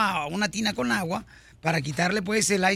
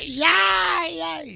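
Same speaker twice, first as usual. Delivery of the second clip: higher mock cry of pain; imitated screaming kid; 9 semitones higher, much slower than usual. Father imitates his son yelling in pain, mock cry. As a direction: ham up the boy yelling. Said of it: "empezó a gritar como loco".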